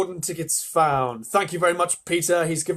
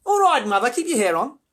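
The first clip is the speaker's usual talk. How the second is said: loud, high Australian retort; cheeky daughter's high-pitched shout; shouting back; 9 semitones higher, 7 dB louder than usual. Acted. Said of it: "Miss Beauregard shouted".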